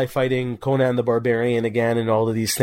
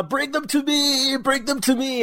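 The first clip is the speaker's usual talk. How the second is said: menacing villain; 12 semitones higher than usual. Retold or imitated. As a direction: croon it like a gleeful prison villain. Quoting villain's menacing line.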